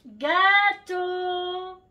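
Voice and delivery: high sing-song call to the dog; high, loud sing-song dog call. Real, not acted. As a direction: call the dog loudly from afar, drawn out. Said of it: "j'essaye de l'appeler".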